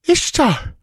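high-pitched gasp of a startled queen. A narrator's reading. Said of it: "gasped Taramis".

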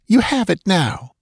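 high-pitched impatient accusation; snapping. A narrator's reading. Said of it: "she snapped".

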